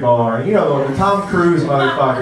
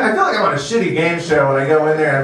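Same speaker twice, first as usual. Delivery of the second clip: ordinary talk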